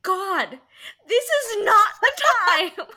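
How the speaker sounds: high, loud mock despair; exasperated outburst. She retells being hacked again after accident, exasperated mock yell. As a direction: cry out to the heavens, breaking into a laugh after.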